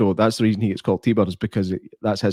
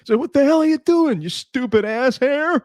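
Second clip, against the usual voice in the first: high, slow hammed-up outraged yell; mock angry driver; slower than usual. Driver gets out of his car, mock furious rant. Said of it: "he's like".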